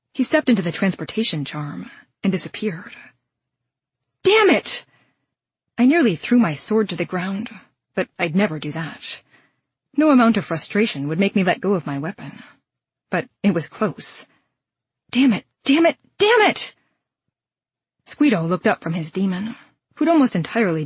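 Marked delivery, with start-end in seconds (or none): 4.2-4.9 high, sharp burst of frustration; frustrated curse. A narrator's reading. Villain escapes, heroine curses. 15.1-16.7 repeated curse climbing much higher; mounting frustration. A narrator's reading. Heroine curses the escape repeatedly.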